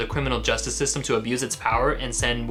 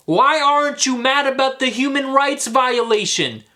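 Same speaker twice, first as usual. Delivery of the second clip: real angry outburst, much higher and louder; 12 semitones higher, 6 dB louder than usual. Real, not acted. Ranting at conservatives who ignore the rights violation, in real fury. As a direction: rant at them, furious and slowing on the last question. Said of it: "Sorry about that".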